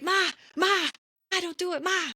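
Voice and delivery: mock-panicked twins begging their mother; panicked sons. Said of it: "They're like".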